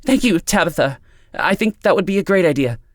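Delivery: high, eager, stumbling gratitude; relieved. A narrator's reading. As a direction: thank her in a rush of nervous relief.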